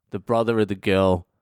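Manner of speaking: ordinary delivery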